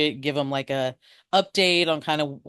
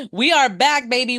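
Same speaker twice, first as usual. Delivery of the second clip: excited; 7 semitones higher than usual. Real, not acted. Hyped return to the show.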